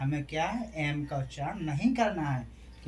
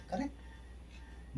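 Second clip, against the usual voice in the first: whisper; 7 semitones higher, 12 dB quieter, much slower than usual. Acted. Teacher confirms answer in aside.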